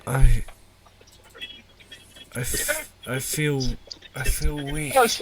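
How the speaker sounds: put-on faint, halting young woman; weak sister voice. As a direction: struggle out each word, drained.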